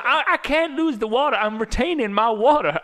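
high, loud whining woman; a hint of a whiny patient; whiny excuse. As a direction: whine the excuse in a mock voice.

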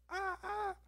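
very high, soft mock yelps; fake cries of pain. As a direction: yelp as if pretending to be whipped. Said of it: "Just act like it hurts".

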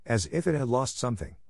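plain reading voice

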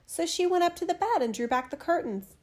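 ordinary delivery

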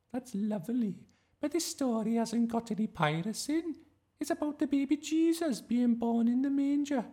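high, loud sing-out teacher voice; fussy drama teacher. A narrator's reading. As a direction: call the class to order, high-pitched. Voices teacher Miss Darling.

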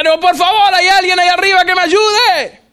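high, pleading cry for help; desperate acted shout. Tiring man begs whoever is above, shouting desperately. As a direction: beg loudly to anyone up above. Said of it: "Entonces grita".

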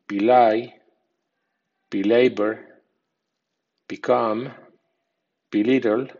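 weak bee sound on be, as in be-LAY, be-COME; light be, main stress on next syllable of belay through beget; be-TRAY, be-GUILE pattern on each word; weak stress on prefix. Shown as a demonstration. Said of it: "bi con acento débil".

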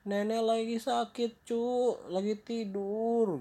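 high-pitched, drawn-out sickly granny; fake grandmother. Wolf pretending to be sick grandma, fake granny voice. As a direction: feign a poorly old grandma. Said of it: "yang pura-pura jadi neneknya".

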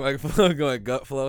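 laughing through the words; cracking up at his own slip. Real, not acted.

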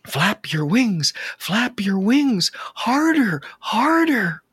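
pleading. A narrator's reading. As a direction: build to high, desperate urging on each repeat. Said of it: "The mother bird pleaded".

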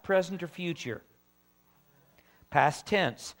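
ordinary delivery

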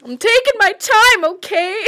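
high squeaky elf voice; a whiny little elf answering back; christmas elf. Pretend.

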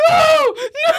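very high, loud mock-panicked shriek; mom's protesting scream. Daughter mimicking mom dodging tickles, screaming protest. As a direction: wail in hammed-up protest. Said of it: "she goes".

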